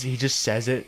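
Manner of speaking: normal talking voice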